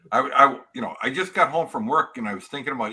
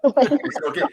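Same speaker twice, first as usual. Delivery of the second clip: laughing; 11 semitones higher, 6 dB louder, slower than usual. Real, not acted. Laughing after the wife joke.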